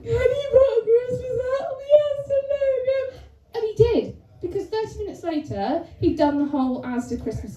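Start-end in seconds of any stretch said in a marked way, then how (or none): 0.0-3.0 very high, squeaky wail; mock tearful plea. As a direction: beg through exaggerated sobs. Tearful plea to boyfriend, acted.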